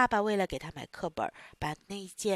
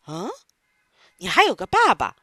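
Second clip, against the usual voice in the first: surprised boss; 9 dB louder than usual. Acted.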